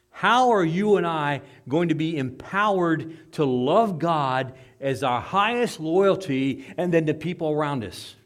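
loud, slow, emphatic pulpit delivery; full-force preaching. Real, not acted. Preacher asking the church how they will love, full-force preaching. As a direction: drive each phrase out to the congregation.

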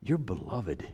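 hushed and tender; soft, intimate. Real, not acted. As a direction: drop to a near-whisper. Tender aside to the congregation.

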